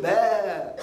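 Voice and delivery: drawn-out mock sheep's baa; sheep bleat.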